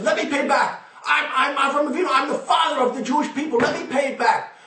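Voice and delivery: high, excited offer with a laugh; a giddy, high-pitched Avram Avinu; eager patriarch. Acted. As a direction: jump in, bursting to pay. Forefather eagerly volunteers in heaven.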